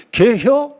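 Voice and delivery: high, drawled Chungcheong country twang; Chungcheong dialect. Retold or imitated. Preacher mimics Chungcheong folk asking about dog soup, in dialect. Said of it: "충청도 사람들은".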